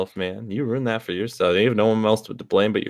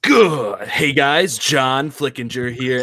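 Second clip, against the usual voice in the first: mock vlogger intro with drawn-out name; exaggerated impression of a host's opening; John Flickinger; 2 semitones higher, much slower than usual. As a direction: greet viewers in a put-on voice. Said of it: "do John".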